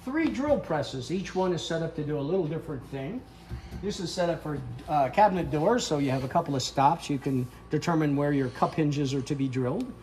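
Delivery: normal talking voice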